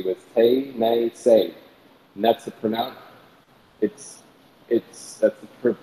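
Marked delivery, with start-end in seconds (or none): 0.3-1.5 Tay Nay Say in slow, separated syllables; Cherokee pronunciation. Shown as a demonstration. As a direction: sound out Tay Nay Say, each syllable rhyming with day. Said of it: "That's how they pronounced that region".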